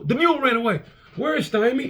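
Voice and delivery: high-pitched little kid impression; high, stiff-necked kid voice; Mickey from The Little Rascals. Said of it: "He was like Mickey from the Little Rascals".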